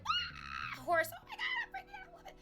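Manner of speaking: very high, loud shriek of delight; shrieking excitement. Retold or imitated. Spotting police horses, shrieking very high with joy. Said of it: "I'm like".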